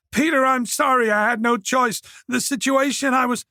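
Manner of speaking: put-on a cornered young man begging; Oliver pleading. A narrator's reading.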